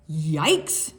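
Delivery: startled loud yikes; yelp. Acted. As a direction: jump in fright. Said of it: "Penguin yelped".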